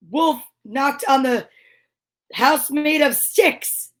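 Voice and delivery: plain reading voice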